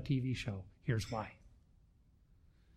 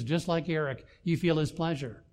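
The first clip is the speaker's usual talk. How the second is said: normal talking voice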